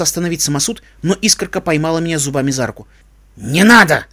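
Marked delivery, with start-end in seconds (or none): 3.4-4.1 loud, fierce bark; forceful, furious command; growled warning. A narrator's reading. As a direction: growl it to stop someone interfering. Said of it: "прорычала она".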